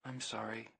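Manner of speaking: as if heard inside his head; a soft message over the feed; silent feed chat. Retold or imitated. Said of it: "she even chatted me".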